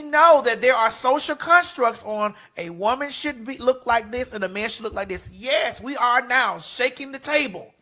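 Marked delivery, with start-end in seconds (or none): none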